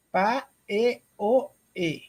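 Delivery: "pa, e, o, e" read as separate syllables, each one spoken slowly and apart; sounding out a misspelling. Retold or imitated. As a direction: spell out "pa, e, o, e" syllable by syllable like a child's wrong spelling. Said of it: "Muitas escreveram assim".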